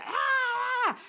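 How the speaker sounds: sudden shrill yelled ah, very high and loud; mock high shout. Politician shouting out the word, very high yell. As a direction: blurt a startled yell. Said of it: "he just says it".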